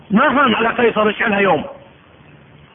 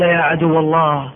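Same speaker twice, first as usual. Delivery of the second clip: solemn martyr; 4 semitones lower, slower than usual. Acted. Martyr answers Caesar.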